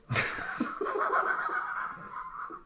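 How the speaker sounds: breathy high giggle at a photo; amused laugh. Real, not acted. Laughing at fun old picture.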